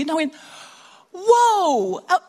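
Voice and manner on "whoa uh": much higher, louder awed whoa; amazed. Retold or imitated. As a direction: gush with astonished admiration. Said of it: "I was just staggered".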